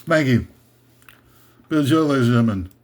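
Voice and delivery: lightly voiced crooner thanking the crowd; lounge singer thanks. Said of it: "That's my [name] imitation".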